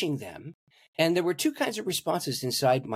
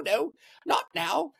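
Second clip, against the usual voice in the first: put-on crazy English accent; 14 semitones higher than usual. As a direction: imitate an English preacher, pitched way up. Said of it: "he had this crazy English accent".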